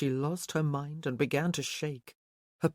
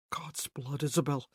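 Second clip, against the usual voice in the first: hoarse, breathless man. A narrator's reading. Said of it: "he said hoarsely".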